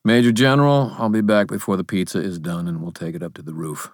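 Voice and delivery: lightly voiced commander, quick and casual; hint of a brisk boss; lord giving orders. A narrator's reading. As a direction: call the rank, then rattle off the plan.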